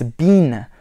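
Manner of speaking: "been" with a long EE, like bean; British BEEN. Shown as a demonstration. Correcting "bin" for beginners, "been" as bean. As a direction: say "been" to rhyme with seen. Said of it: "is pronounced bean".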